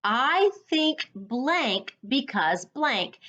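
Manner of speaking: slow, deliberate model sentence; slow and clear. Shown as a demonstration. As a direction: spell it out slowly for students. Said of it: "This is my frame".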